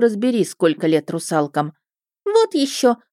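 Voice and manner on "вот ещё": put-on much higher girlish voice; huffy refusal from a young mermaid; indignant mermaid. A narrator's reading. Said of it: "возмутилась та". (возмущённая юная русалка)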